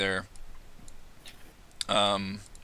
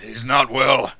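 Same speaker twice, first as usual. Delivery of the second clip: a lightly voiced, grumbling dwarf, a touch louder; 3 semitones higher, 6 dB louder, much slower than usual. Captain Firebeard to the captains, lightly voiced and grumbling. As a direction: grumble it out like a gruff old dwarf. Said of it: "the dwarf just kind of grumbles".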